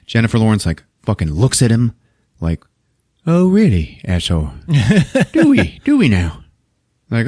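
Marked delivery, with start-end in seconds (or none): 3.3-6.4 sarcastic. Retold or imitated. Wife sarcastic to husband.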